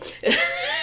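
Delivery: squeaky high giggle; quick high-pitched laugh. Real, not acted. Laughing between quoted lines.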